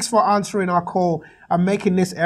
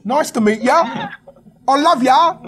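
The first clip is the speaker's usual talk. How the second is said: put-on English accent; 4 semitones higher than usual. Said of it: "me with my weird English accent".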